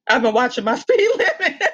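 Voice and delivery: retold protest breaking into a laugh; laughing retort. Retelling a reply to the officer, laughing. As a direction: let a laugh crack the mock argument.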